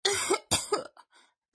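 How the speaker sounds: hammed-up sick bear; pitiful coughing. A narrator's reading. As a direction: poorly bear voice with a feeble cough. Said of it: "Bear murmured, coughing pitifully".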